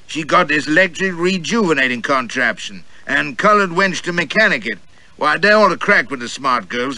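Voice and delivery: a pompous gentleman sounding off at the next table; loud Southern gentleman. Acted. Gentleman at the next table to his companion, blustering high and loud. Escort boasting about Tilda's contraption.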